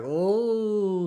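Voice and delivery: high surprised oh; a high taken-aback oh; mock surprise. Vlogger imitating own surprised reaction, high oh. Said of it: "I was like".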